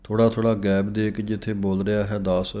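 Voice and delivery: ordinary delivery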